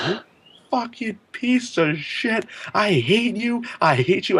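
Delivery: high, shrill-pitched furious cursing; angry yelling. Retold or imitated. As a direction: scream it at your friend in a panic. Friend cursing him on tracks.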